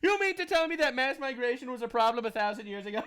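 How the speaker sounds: high mock incredulity, amused; sarcastic. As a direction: feign astonishment between laughs, starting high. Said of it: "I have to stop with the sarcasm".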